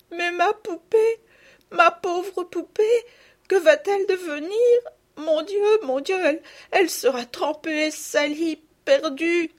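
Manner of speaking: voix aiguë et forte, hachée de sanglots; sanglots d'enfant. Acted. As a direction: une petite fille qui pleure sa poupée. Enfant désespérée pour sa poupée. (sanglots d'enfant aigus et forts)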